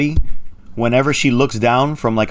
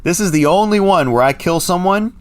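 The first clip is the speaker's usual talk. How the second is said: usual speaking voice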